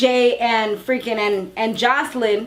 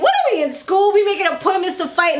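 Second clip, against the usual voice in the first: mocking; 7 semitones higher, faster than usual. Real, not acted. Mocking the staged fight.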